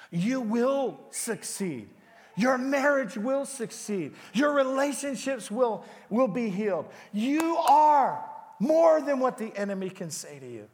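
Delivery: preacher building to a passionate declaration; rising preaching. Acted. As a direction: declare victory over them. Preaching at full force.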